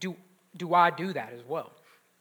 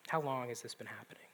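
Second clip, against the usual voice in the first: soft whispered question, quicker; gentle whisper; 7 semitones lower, 14 dB quieter, faster than usual. Retold or imitated. Preacher repeating Jesus' gentle question to the father. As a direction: hush it like comforting a hurting father. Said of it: "His gentle question to this father".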